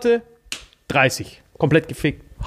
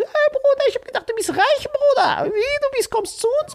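very high put-on mocking voice; mocking Turkish guys. Turkish passengers mocking the storyteller, very high put-on voice. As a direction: squeal it like teasing onlookers. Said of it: "die so".

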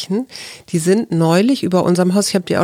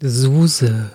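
low, drawn-out hotline announcer; phone menu voice; 6 semitones lower than usual. Acted. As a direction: say your name like a recorded phone greeting. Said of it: "für diesen etwas, äh, Telefonansagen-Sound".

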